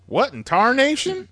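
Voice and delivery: put-on high, squawky old prospector. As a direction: play a crusty old prospector. Riffing on the cowboy scene.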